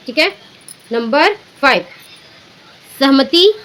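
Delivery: ordinary delivery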